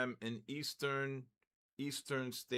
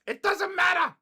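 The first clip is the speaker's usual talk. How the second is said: much higher and louder, shouted out; 12 dB louder than usual. Real, not acted. Trader yelling at his screen, high and loud. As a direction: blurt it out in a squealing yell. Same outburst again.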